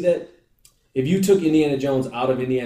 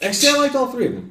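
hurried backpedal; 10 semitones higher, much faster than usual. Real, not acted. Backpedaling under mock threat.

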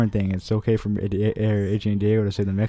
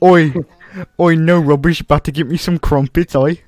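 loud higher mock British oi; mock British shout; 5 semitones higher, 6 dB louder than usual. Friend shouting mock British slang for laughs. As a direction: holler like a cartoon London lad. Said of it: "for British".

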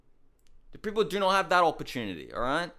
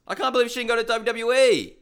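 incredulous rant; 7 semitones higher, 5 dB louder, faster than usual. Real, not acted. Ranting in disbelief.

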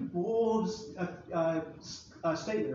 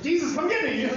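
higher, louder, laughing cry for help; mock panic; 6 semitones higher, 4 dB louder than usual. Peter sinking, yelling to Jesus in mock panic. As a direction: ham up a drowning man's yelp. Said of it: "he's like".

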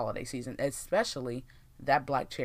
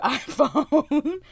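laughing; 12 semitones higher, 9 dB louder than usual. Real, not acted. Laughs mid-sentence.

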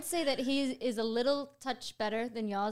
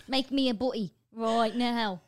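slow, laughing mock Cockney; Cockney accent; 2 semitones higher, 4 dB louder, slower than usual. Wife imitating her man wanting food, Cockney accent. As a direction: order it drawn out in put-on Cockney. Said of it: "more like of a Cockney".

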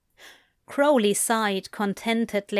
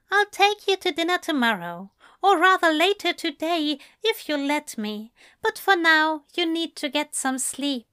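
higher, fast and fond; gentle Aziraphale voice; 7 semitones higher than usual. Acted. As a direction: speak like a doting angel. Aziraphale speaking to Crowley.

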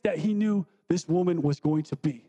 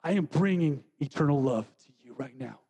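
tender promise; 6 semitones lower than usual. Acted. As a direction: offer it gently, lower. Speaking as Jesus.

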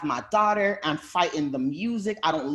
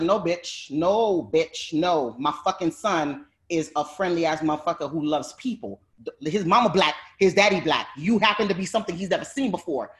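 retold angry retort. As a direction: snap back at her, then blow up. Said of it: "I was like".

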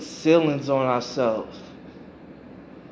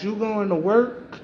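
put-on child's question; 6 semitones higher than usual. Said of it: "he would ask".

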